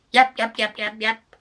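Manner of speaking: high, loud yaps; yapping dog. Acted. Harry McCleary yaps up the tree, high. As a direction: yap like a small dog. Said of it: "said Harry McCleary".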